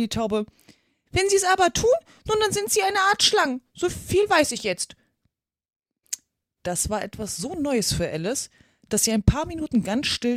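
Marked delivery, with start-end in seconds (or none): 1.1-5.0 high-pitched, insistent bird voice; stubborn pigeon. A narrator's reading. Pigeon classing little girls as snakes, stubborn. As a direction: argue it out as a cross pigeon.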